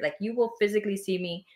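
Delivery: snatch a startled breath as if a spirit enters; sharp demo gasp. Shown as a demonstration. Medium showing the host how channeling looks, sharp gasp. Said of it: "sometimes I will breathe out a spirit".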